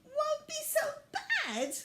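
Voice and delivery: high, slow outraged echo; indignant cry. A narrator's reading. Violet crying out at Miss Biscuit's words. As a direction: cry it back in disbelief. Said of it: "cried Violet".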